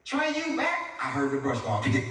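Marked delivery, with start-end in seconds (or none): 0.0-1.0 put-on high-pitched kid calling a question; young girl. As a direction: pipe up like a nine-year-old sister. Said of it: "Soon as she heard".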